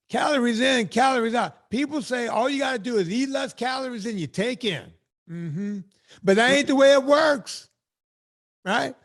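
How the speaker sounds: sermonize about calories like a pulpit; preacher voice. Pretend.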